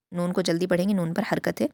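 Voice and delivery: usual speaking voice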